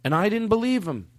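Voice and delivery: ordinary talk, nothing marked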